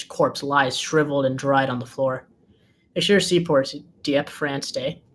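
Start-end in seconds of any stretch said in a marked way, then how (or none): none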